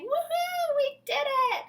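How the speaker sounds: high, loud joyful whoop; shrieking kids celebrating; cheering. Acted. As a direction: cheer like a kid who just won. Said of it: "the kids were cheering".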